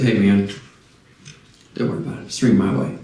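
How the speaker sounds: low, easygoing buddy voice; laid-back Elisha. Retold or imitated. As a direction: shrug it off like a relaxed pal. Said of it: "That's [name]'s version of this".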